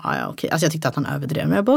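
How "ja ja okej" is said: low, quiet grudging okay; reluctant okay. Retold or imitated. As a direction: give in without believing it. (motvilligt, lågt och tyst okej)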